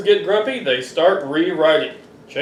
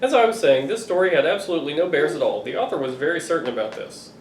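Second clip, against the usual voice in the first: ordinary delivery, nothing marked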